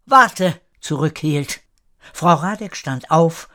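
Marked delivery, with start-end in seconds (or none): 0.0-0.6 sudden high, loud call; raised-voice halt; calling him back. A narrator's reading. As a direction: stop him with one sharp word. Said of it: "als ihn ein Warte zurückhielt".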